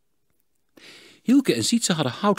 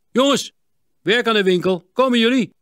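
father calling loudly from the workshop, higher; 14 semitones higher, 9 dB louder than usual. A narrator's reading. Father calls his sons to come work, loud call. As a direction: call the boys in, loud and high. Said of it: "vader hen riep".

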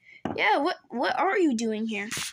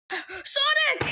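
calling out; 12 semitones higher than usual. Acted. Calling out to Sonic.

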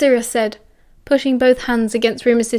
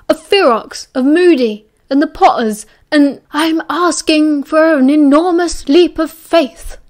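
louder and higher, firm but not shouting; 5 semitones higher, 5 dB louder than usual. Acted. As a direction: override him with sheer volume. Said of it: "Dumbledore said very loudly".

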